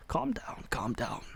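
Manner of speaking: hushed advisor hissing calm down; a secretive whisper. Acted. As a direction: whisper it urgently to calm him.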